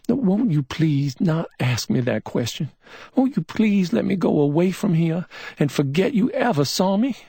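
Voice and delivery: high, pleading plea; pleading woman. A narrator's reading. Billy begs McMain not to ask, pleading. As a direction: beg him to let her go. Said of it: "she pleaded".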